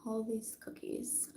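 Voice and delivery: unvoiced, slowed whisper. Real, not acted. Whispering a quick aside to the viewer. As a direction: hush it like a secret. Aside while reading scent descriptions.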